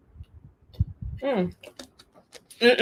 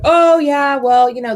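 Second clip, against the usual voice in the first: breezy excuse; 6 semitones higher, 5 dB louder than usual. Retold or imitated. Mom brushing it off.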